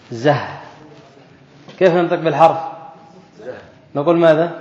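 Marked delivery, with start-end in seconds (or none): 0.1-0.4 زه said as a bare z with a short breathy h, not the name زاي; letter sound demo. Shown as a demonstration. Teacher showing students how to sound the letter, bare letter sound. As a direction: sound out زه as just the letter with a soft ending h. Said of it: "كيف ننطق بالزاي".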